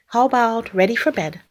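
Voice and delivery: ordinary delivery, nothing marked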